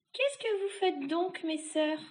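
high, drawn-out witch's call. Acted. Third witch to her sisters from the stairs, high drawling call. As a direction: call slowly to your sisters, high-pitched.

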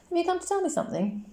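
put-on high, curious girl's voice; Coraline asking. A narrator's reading. Child Coraline asks the cat.

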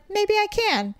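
high, hopeful musing; retold wishful thinking; hopeful thought. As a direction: pitch it up, tempted by the idea. Retelling her own hopeful thought.

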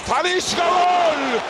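drawn-out screamed goal; screaming football commentator. Real, not acted. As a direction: stretch the goal call, shouting. Penalty scored, goal call.

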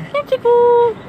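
ordinary talk, nothing marked

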